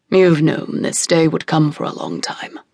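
low, heavy grave voice; father telling the weeping mother; stern father king. A narrator's reading. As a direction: speak like a solemn king refusing. Father figure speaking.

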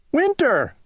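high, sharp cry of the name. Acted. Owl to rowdy Winter, crying out its name. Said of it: "cried Owl".

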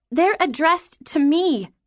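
put-on high, slow, insistent girl; defiant child. Daughter standing up to father, defiant.

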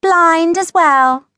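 high, slow, sing-song sweetness; loud, drawn-out sweet taunt; mock sweet. A narrator's reading. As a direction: tease with sugary innocence. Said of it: "Clipper said sweetly".

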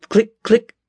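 high, sharp squirrel clicks; squirrel bark. Acted. Taunting squirrel clicks at the narrator, high barking. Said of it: "the squirrel barked".

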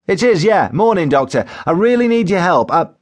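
desperate patient launching into his problem; Joe Windass, needy caller. A narrator's reading.

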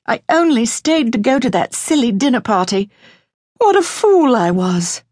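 high-pitched, anguished self-reproach; distressed cry. A narrator's reading. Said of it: "she cried".

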